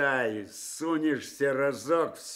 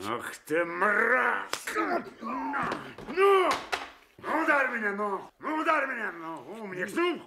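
bait him to swing, furious and higher; furious goading; 7 semitones higher than usual. Acted. Captain daring the detective to hit him, furious.

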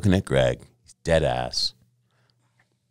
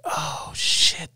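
stunned whisper; 11 semitones higher than usual. Retold or imitated. Stunned by idol's put-down.

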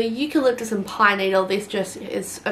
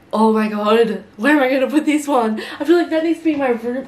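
excited; 4 semitones higher, 5 dB louder, faster than usual. Real, not acted. Excited over the scent.

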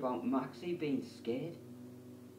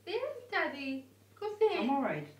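high sing-song dog talk; 13 semitones higher than usual. Real, not acted. Talking to the dog.

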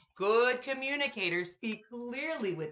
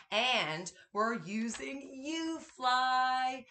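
ordinary delivery

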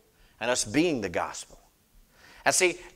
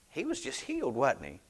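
retold amazement; 8 dB quieter than usual. Preacher retelling his question to the mother, soft amazement. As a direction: ask it softly, as if amazed. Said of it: "I'm just looking in amazement".

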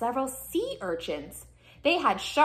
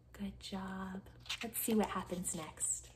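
very soft, near-whispered praise; hushed; 2 semitones lower, 13 dB quieter than usual. Real, not acted. Reader praises children after sad faces, hushed. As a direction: drop right down, almost to a whisper.

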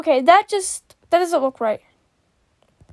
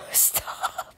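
laughing whisper; 13 dB quieter than usual. Real, not acted. Laughing at fan art.

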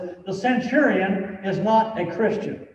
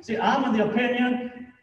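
rising, louder preacher's build; preaching at full force; 5 semitones higher, 3 dB louder than usual. Acted. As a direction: preach it up, climbing higher and louder.

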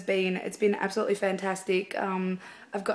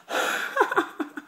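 laugh; 13 semitones higher than usual. Real, not acted. Laughs after getting tongue-tied.

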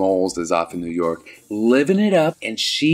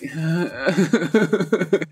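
high mock drunken chuckle; put-on giggle; 7 semitones higher than usual. Recapper imitating the hammered man's reply, giggling tipsily. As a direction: giggle stupidly as the drunk boyfriend. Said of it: "And he's like".

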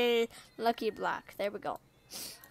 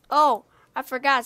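ordinary talk, nothing marked

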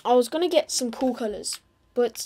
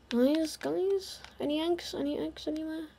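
higher, softer coaxing to the chickens; 5 semitones higher, 6 dB quieter than usual. Acted. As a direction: sweet-talk the hens for eggs.

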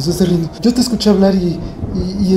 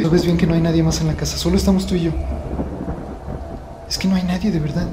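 much softer, hushed uneasy words; 7 dB quieter, faster than usual. Real, not acted. Vlogger hushed and scared, softly reassuring himself. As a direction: say it under your breath, scared.

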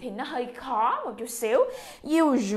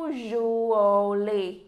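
"Usually" said slowly, leaning on the first u; stress on u; 2 semitones lower, 3 dB louder, much slower than usual. Shown as a demonstration. Teacher models "Usually" slowly, stressing the u. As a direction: stretch "Usually" and hit the opening u. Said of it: "Bốn tiếng chúng ta nhấn chỗ chữ u".

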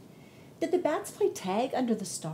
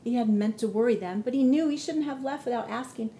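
ordinary delivery

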